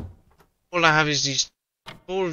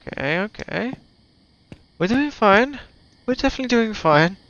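nervous reassurance; 7 semitones higher than usual. Real, not acted. Nervous self-reassurance in horror game.

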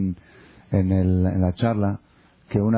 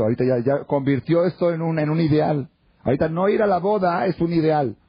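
rising, higher-pitched incredulous emphasis; mocking disbelief; 7 semitones higher than usual. Real, not acted. Rabbi mocking how the brother made refusal an ideal, incredulous. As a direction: marvel sarcastically, pitch climbing.

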